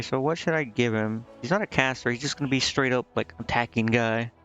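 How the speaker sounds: ordinary talk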